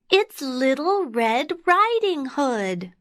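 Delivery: put-on high child's voice; high, innocent storybook girl. A narrator's reading. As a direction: announce yourself as a high-voiced little girl. Real girl names herself.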